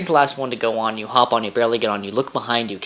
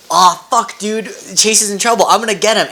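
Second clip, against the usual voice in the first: higher, louder panicked call for help; alarmed Kudzu; 7 semitones higher, 4 dB louder than usual. Retold or imitated. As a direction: play the boyfriend rushing to help, higher and louder. Kudzu voiced in retold scene.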